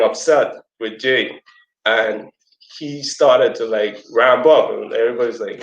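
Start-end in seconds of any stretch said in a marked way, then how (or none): none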